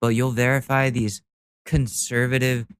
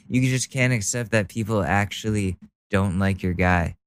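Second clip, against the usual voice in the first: ordinary talk, nothing marked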